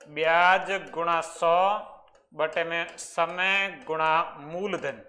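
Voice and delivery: slow, spaced-out dictation of the formula. Shown as a demonstration. Teacher dictating the rate formula to students, slowly. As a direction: spell out each term slowly for the class to copy. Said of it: "ये दर का formula".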